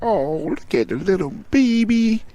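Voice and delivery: put-on high, slow baby-talk coo. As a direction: coo it like you're gushing over an infant. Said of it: "you just gonna be like".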